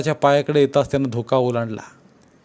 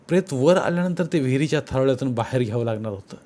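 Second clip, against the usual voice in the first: plain reading voice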